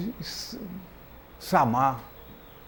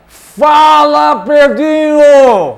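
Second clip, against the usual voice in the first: calling out; 9 semitones higher, 11 dB louder, much slower than usual. Acted. Show opening greeting, calling out.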